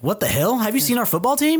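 as if the town had just been insulted; mock outrage, voice jumping up high; indignant disbelief. Voicing indignant Texans defending their town.

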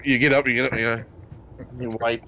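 ordinary delivery

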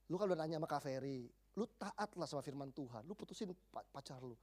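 low, hushed counsel, acted; soft imitation of a concerned friend; gentle friendly advice. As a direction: quietly urge a friend to obey. Best friend gently advising her.

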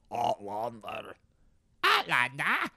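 exaggerated Scottish burr; Scottish accent. Naming the TV show, mock Scottish accent. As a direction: say the show title like a Scot. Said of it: "Scottish accent".

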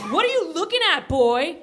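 high snapping yell. Acted. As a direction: yell it at him. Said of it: "yelled Bobby".

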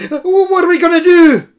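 fast, high, loud stammering panic; panicky. Acted. Koma panicking to Kalava in the wilds, fast and high. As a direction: blurt it out in a fluster.

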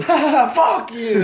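loud, high jeering laugh and curse; mock the engineer through the speakers. Singer jeering at Raleigh, loud laughing taunt. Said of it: "felt like going".

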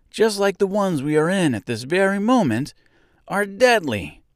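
high-pitched, urgent scarecrow; worked-up warning; alarmed realization. A narrator's reading. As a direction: voice climbs as the danger dawns. Scarecrow realizes the poppies are deadly.

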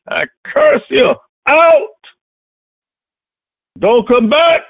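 loud, high, slow rebuke; laying a curse. Real, not acted. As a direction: cast the sickness out forcefully. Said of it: "That's the spirit of infirmity".

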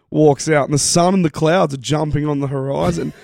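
ordinary talk, nothing marked

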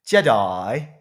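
low, loud imitation of Cuppa; lightly voiced Cuppa saying Jedi; Cuppa impression. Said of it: "What's your best Cuppa impression?".

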